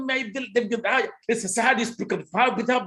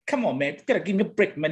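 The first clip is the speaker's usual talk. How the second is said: ordinary delivery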